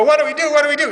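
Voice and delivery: high, loud panicked cry of imagined churchmen; mock panic. Imagined bishops panicking about heretics, loud mock panic. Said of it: "and he would go".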